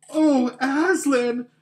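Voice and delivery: high, loud, slow exclamation; awed cry. Acted. Children see Aslan alive, frightened glad cry. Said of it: "cried both the children".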